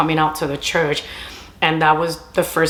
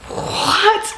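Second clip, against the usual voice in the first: very high, squeaky what; mock astonishment; 14 semitones higher than usual. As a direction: squeal it in hammed-up disbelief. Retold shock while crying.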